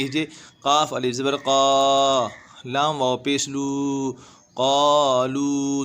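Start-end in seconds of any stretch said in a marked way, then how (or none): 4.6-5.8 क़ालू with long aa and long oo; both vowels stretched. Shown as a demonstration. Teacher showing alif and wav madd, क़ालू drawn out. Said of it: "दोनों खींचे".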